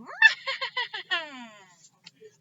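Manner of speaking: high loud cackling laugh; witch cackle. Acted. Witch Gritch cackling above the farm, high cackle. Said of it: "she cackled".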